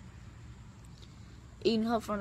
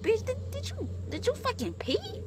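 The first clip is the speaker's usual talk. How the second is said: much higher stammering outrage; high incredulous stammer; appalled accusation. Retold or imitated. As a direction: sputter it in disbelief. Accusing partner of peeing.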